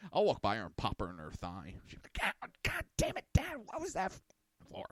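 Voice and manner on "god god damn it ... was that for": put-on much higher voice, a teen girl protesting; daughter's indignant whine. Daughter complains to dad after being popped, high indignant voice. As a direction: mimic the daughter squealing in indignation.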